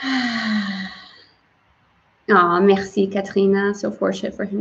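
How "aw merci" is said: usual speaking voice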